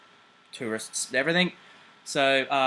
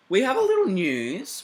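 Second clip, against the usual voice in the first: singsong; 10 semitones higher, 6 dB louder than usual. Acted. Singsong news teaser.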